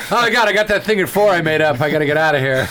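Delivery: hammed-up flustered exit; mock panic. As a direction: act late for a made-up appointment. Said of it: "rushing to these fake appointments".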